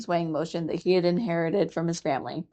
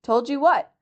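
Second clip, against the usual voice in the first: impatient mongoose; 7 semitones higher, 5 dB louder than usual. Acted. Rikki presses the muskrat.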